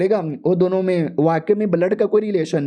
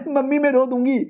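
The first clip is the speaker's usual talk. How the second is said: put-on much higher childish whine; hammed-up whiny daughter; whiny little girl; 7 semitones higher than usual. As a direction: whimper like a small girl. Daughter threatens to cry.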